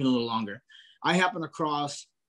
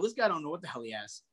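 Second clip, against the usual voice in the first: usual speaking voice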